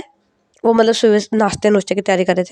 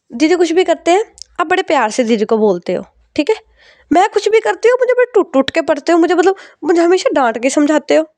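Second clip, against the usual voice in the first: whiny complaint; 7 semitones higher than usual. Acted. Daughter complains to mother about unfair scolding, high whine. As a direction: complain it's unfair, voice climbing.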